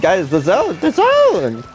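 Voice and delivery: high-pitched, urgent cry; exasperated yelling. Real, not acted. Yelling at players over zone.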